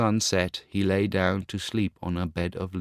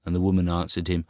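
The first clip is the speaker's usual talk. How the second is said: ordinary delivery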